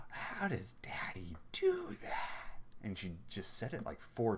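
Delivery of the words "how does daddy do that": slow, high whisper full of wonder; breathy, drawn-out amazement with a lifted ending; astonished child. Retold or imitated. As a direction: imitate an awestruck little girl under the breath. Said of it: "like, astonishment".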